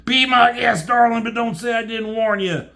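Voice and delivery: high, loud mock-gracious retort; taunting character voice. Comic reader voicing a sarcastic go-ahead, high and taunting.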